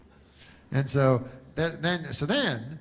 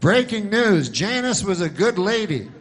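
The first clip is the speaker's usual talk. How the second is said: higher, louder breaking-news voice; hammed-up newscaster breaking a story; mock news announcer; 6 semitones higher, 5 dB louder than usual. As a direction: announce it like a TV anchor.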